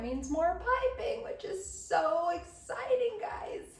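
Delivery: high, slow mock enthusiasm; sarcastic excitement. Real, not acted. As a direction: fake cheer through gritted teeth. Sarcastic about more piping.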